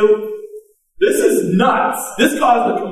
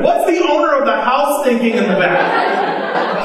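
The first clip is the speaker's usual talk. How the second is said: normal talking voice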